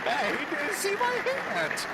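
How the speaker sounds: very high, slow, put-on wounded voice; mock hurt squeak. Streamer voicing the snubbed lawmaker, very high mock hurt voice. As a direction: squeak it like a kid whose handshake got ignored.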